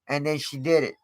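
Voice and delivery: usual speaking voice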